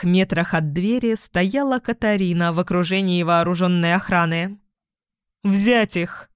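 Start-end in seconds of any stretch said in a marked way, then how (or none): 5.4-6.3 shouted order. Acted. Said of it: "крикнула она".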